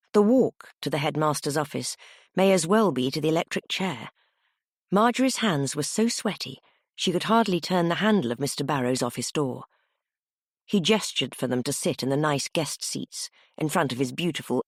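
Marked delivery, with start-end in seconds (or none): none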